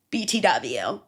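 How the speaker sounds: ordinary talk, nothing marked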